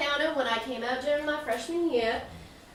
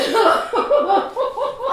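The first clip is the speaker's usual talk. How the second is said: teary laugh; 6 semitones higher, 8 dB louder than usual. Real, not acted. Sees mother crying, laughs.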